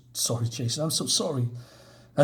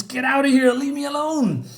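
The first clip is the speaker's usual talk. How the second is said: much higher, louder cry to be left alone; desperate shout; 11 semitones higher, 3 dB louder than usual. Acted. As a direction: drive the demon away in anguish. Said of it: "He says".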